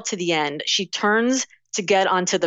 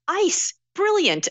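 much higher, bright mock delight; delighted friend; 12 semitones higher, much slower than usual. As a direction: exclaim it like a genius discovery. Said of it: "she's like".

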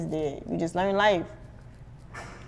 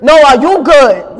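loud, slow confrontational comeback; acted belligerent New Yorker; disrespectful New Yorker; 5 semitones higher, 16 dB louder, slower than usual. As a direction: throw the question back hard and higher. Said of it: "disrespectful one".

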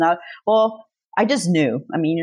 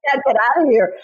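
laughing echo; 5 semitones higher than usual. Real, not acted. Echoing co-host joke.

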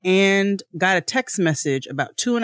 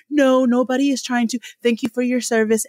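mock polite rep; 9 semitones higher than usual. Mocking the rep's reply.

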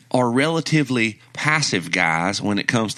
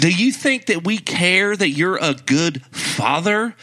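mock scolding; 7 semitones higher than usual. Mock scolding Westbrook as fans.

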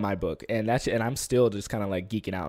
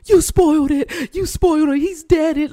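much higher and louder, slow, whiny accusing voice; mock the nagging commenter; 10 dB louder than usual. Imitating TikTok commenters accusing her, high and whiny.

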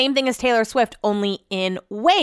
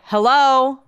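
louder, more insistent hello; imitated caller; 3 semitones higher, 5 dB louder than usual. Stranger answering back on the call, imitated insistent hello. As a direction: throw back the greeting as the caller. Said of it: "And they're like".

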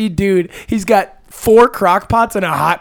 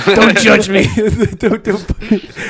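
laughing; 3 semitones higher, much slower than usual. Real, not acted. Laughing defense of eating.

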